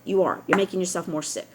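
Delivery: ordinary delivery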